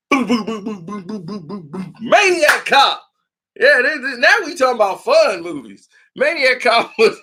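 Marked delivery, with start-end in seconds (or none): 0.1-1.9 mock gun sounds getting softer; imitated gunfire. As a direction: boom boom boom fading to nearly nothing.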